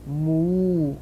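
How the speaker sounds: long, drawn-out moos; imitated cattle lowing. A narrator's reading. Cattle stuck in the road, long lowing moos. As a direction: stretch each moo out like a cow. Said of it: "went the cattle".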